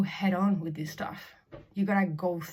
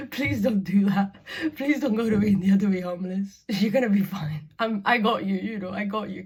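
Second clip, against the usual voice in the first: loud, imploring imitation of a friend; friend's pleading; 3 semitones higher, 8 dB louder than usual. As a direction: plead loudly, then reassure, easing off at the end. Acts out friend's worried plea.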